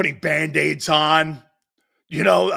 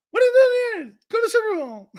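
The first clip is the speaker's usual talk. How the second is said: much higher, louder dummy voice breaking into laughter; puppet voice, laughing; 5 dB louder, faster than usual. Retold or imitated. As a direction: squeak it like the dummy, cracking up. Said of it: "like a ventriloquist".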